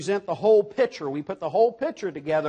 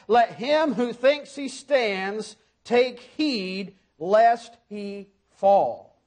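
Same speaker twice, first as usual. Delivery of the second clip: solemn scripture reading; much slower than usual. Acted. Reads warning verse slowly.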